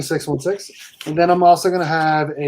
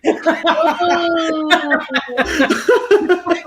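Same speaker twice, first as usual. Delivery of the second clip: long, high burst of laughter; real hearty laugh; 12 semitones higher than usual. Real, not acted. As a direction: crack up helplessly at a friend's story. Cracking up at toddler attitude story.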